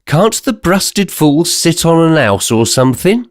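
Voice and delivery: a London bystander sneering at the giant; lightly voiced Cockney heckler; jeering Cockney.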